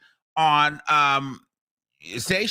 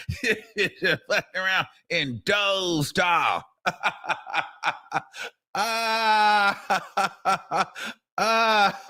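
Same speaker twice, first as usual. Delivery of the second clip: laughing; much slower than usual. Real, not acted. Cracking up over the reporter.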